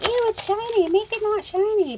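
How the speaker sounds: high, whiny Ew, imitating fussy people; mock the shine haters with a whine; mock disgust. Vlogger mimicking crafters who hate shiny paper, whiny mock disgust. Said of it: "People are always like".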